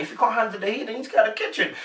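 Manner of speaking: light, bantering challenge; joking banter. Retold or imitated. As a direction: lift it up lightly, as teasing banter. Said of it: "she was joking and bantering".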